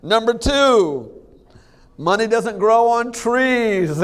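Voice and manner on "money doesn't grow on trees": mock dad lecture. Dadism quoted slowly.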